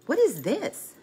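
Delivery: high-pitched imitation of a curious child; a hint of a five-year-old; curious grandson. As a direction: lightly voice a little boy's puzzled question. Grandson's curious question retold.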